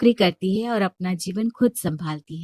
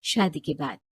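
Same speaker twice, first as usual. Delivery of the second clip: plain reading voice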